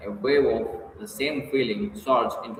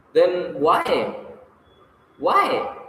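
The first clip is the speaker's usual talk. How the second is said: higher-pitched urgent questioning; quick, grasping question; 6 semitones higher, 3 dB louder than usual. A narrator's reading. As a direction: press for an answer, catching at a straw. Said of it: "she asked quickly, catching at a straw".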